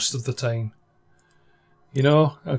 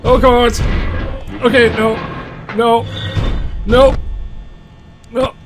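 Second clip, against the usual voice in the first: frightened pleading; 7 semitones higher than usual. Real, not acted. Hearing the animatronic close by.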